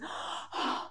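sharp open-mouth gasp in; demo gasp. Shown as a demonstration. Coach showing the class a breath: quick gasp. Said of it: "is to just".

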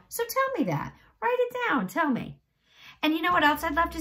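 high, bright urging; eager teacher. Real, not acted. Teacher to pupils online, high eager urging.

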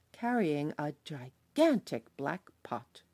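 plain reading voice

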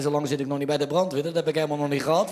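ordinary talk, nothing marked

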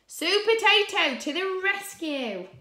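high, loud superhero fanfare; heroic announcement. A narrator's reading. Reader heralding the hero for children, heroic announcement. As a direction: proclaim the hero's arrival.

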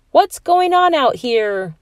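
high, slow mock-stern mom; mom calling out. A narrator's reading. Mom at the kitchen door, calling out. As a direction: call out from the door.

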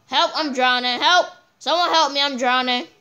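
loud high help calls; mock drowning cries. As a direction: shout as if drowning. Pretend drowning in game.

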